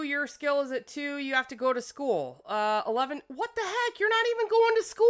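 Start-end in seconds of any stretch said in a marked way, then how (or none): 3.3-5.1 indignant surprise. Real, not acted. Kids skipping school.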